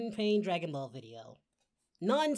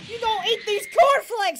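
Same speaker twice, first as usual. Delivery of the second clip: mock taunt; 8 semitones higher, 7 dB louder than usual.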